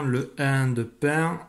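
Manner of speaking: "le un de pain" drawn out slowly, un stressed. Shown as a demonstration. Dictating to a child, slow and careful. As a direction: stretch "pain" so the nasal un rings. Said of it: "j'entends surtout le son un".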